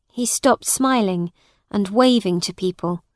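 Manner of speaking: usual speaking voice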